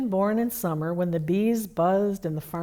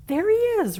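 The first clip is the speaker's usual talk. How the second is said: higher, louder excited shout; 13 semitones higher, 5 dB louder than usual. A narrator's reading. Rabbit shouting as he spots Santa. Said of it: "Rabbit shouted again".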